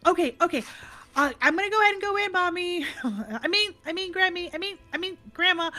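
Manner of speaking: put-on high, fast, breathless little girl voice; excited little girl. Granddaughter to grandma about the car seat, high excited child voice. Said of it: "she's like".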